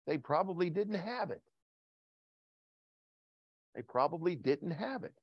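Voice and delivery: very soft, suggestive aside; hushed revelation. Real, not acted. Preacher revealing why blood went unmentioned, hushed. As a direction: let the answer out quietly.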